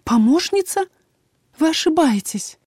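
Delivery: high, disbelieving protest; alarmed, incredulous objection; voice trembling. A narrator's reading. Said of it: "Мой голос дрогнул".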